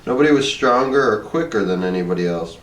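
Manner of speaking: plain reading voice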